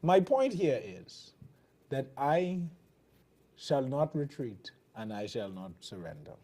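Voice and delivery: ordinary talk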